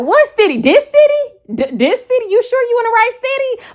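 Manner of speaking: high, loud mocking questions; hammed-up teasing what city; teasing disbelief. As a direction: rib him with squeaky disbelief. Teasing the man.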